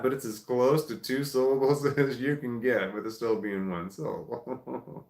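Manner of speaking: ordinary talk, nothing marked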